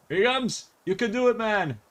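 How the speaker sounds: root for him in a high, eager voice; mock cheering on. Viewer urging on the man returning, playfully excited.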